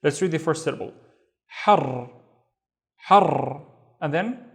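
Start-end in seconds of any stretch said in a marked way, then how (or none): 1.6-3.6 "Har" with a long rolled r; teacher's drawn-out r on "har"; doubled ra. Shown as a demonstration. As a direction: hold and roll the r ending "Har". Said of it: "we need to pronounce the ra twice".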